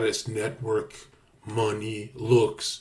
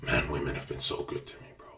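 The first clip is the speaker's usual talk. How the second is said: hushed whisper; 11 semitones lower, 9 dB quieter, faster than usual. Real, not acted. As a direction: drop to a secret whisper. Confiding aside.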